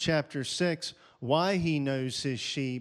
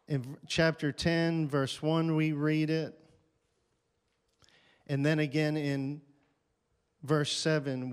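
ordinary delivery

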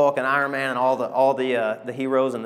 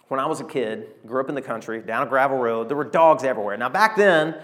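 ordinary delivery

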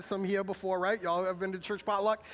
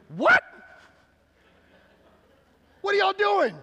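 much higher and louder, incredulous; emphatic rebuke; 11 semitones higher, 9 dB louder than usual. Retold or imitated. Preacher voicing Paul scolding the Corinthians, loud and outraged. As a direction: hit them with Paul's shocked outrage. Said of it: "It's like emphatic".

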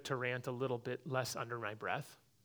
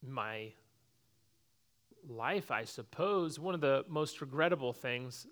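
ordinary talk, nothing marked

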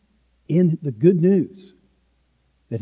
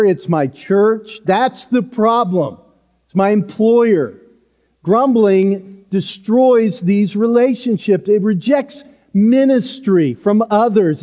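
louder, rising preacher's build, driving each line home; preaching at full force; 6 semitones higher, 5 dB louder than usual. Acted. Preacher warning the congregation about grumbling, forceful and loud. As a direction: hammer each phrase like a pulpit warning.

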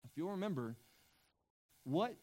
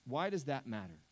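ordinary talk, nothing marked

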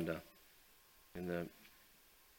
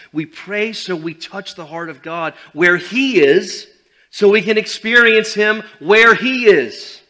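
fiery preaching; 9 semitones higher, 17 dB louder than usual. Acted. Preaching at full force.

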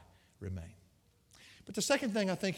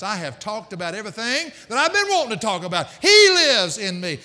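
louder, higher, slowing into He lives in me; joyful old lady; 5 semitones higher, 10 dB louder than usual. Retold or imitated. Preacher voicing elderly church lady telling him her joy, excited. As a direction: burst with joy at the discovery. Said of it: "She said".

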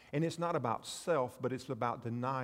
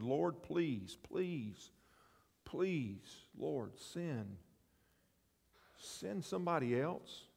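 soft pleading; 6 dB quieter, much slower than usual. Acted. Moses pleading again softly.

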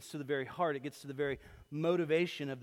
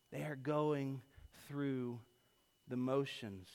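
They are going, through, the motions, each word set apart with pauses; slow, paused repeat; 4 dB quieter, slower than usual. Shown as a demonstration. Preacher repeats God's words slowly, with pauses. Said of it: "repeats the quote".

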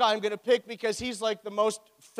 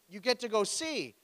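ordinary talk, nothing marked

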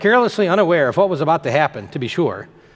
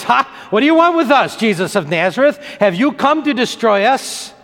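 crying out; 6 semitones higher than usual. Retold or imitated. Possessed man to Jesus in the synagogue, crying out. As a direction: cry out in raised-pitch alarm, acting the demon. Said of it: "he cries out at the top of his voice".

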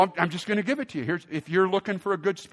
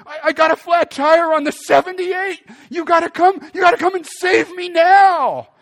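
high, loud, urgent begging; stranded on the freeway, begging him to come, panicked; panicked plea; 13 semitones higher, 11 dB louder than usual. Retold or imitated. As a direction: plead for rescue over the roar of traffic. Stranded wife begging for rescue by phone.

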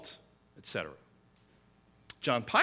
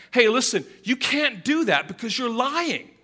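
higher, louder mock coach telling-off; scolding coach; 7 semitones higher, 5 dB louder than usual. Coach to the quarterback over a fake, mock scolding. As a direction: play an indignant coach, slowing down.

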